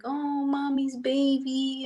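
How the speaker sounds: put-on high, slow doting baby talk. Owner cooing at her aging dog, high slow baby talk. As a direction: dote on the old dog like a baby. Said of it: "I'm always like".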